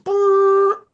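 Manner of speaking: very high held trill; imitated car screech. Storyteller sounds out the car stopping, high screech. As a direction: squeal like a car pulling up.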